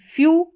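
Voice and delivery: "फ्यू" with a high, loud yu glide; yu sound. Shown as a demonstration. Teacher demonstrates the yu sound to students, emphatic. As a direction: stress the yu in "फ्यू". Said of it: "यू sound आ रहा है".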